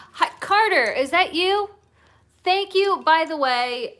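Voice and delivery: high, loud delighted call to a viewer; light up and call out his name; thrilled shout-out to a friend; excited greeting. Real, not acted.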